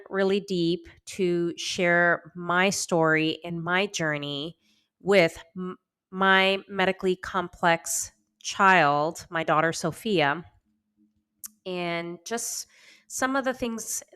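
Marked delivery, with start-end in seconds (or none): none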